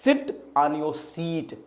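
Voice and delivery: slow demo, short 'sit', long 'seat'; 'seat' drawn out after a short 'sit'; correct pronunciation. Shown as a demonstration. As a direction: stretch the ee of 'seat', clip the i of 'sit'. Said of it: "अगर knowledge है तो बोलेगा".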